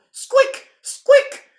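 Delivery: very high loud squeaks; squeaking mouse. A narrator's reading. Loud Land mice squeaking, high and loud.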